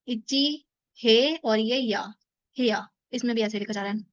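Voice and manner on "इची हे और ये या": slow syllable spelling; spelled slowly. Shown as a demonstration. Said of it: "room को कहते हैं हेया". (धीरे-धीरे अक्षर)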